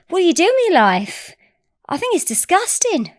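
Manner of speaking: high-pitched, rough attempt at Australian; imitated Australian accent, not quite there. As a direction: have a go at an Australian woman. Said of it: "Is that an Australian accent?".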